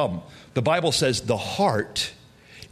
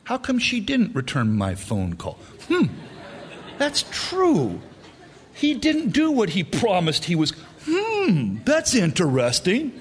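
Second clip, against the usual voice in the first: higher, musing mock inner voice; inner thoughts; 4 semitones higher than usual. Listener stewing over a slight in his head, higher smug musing. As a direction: muse smugly as if building a case. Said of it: "and we're thinking".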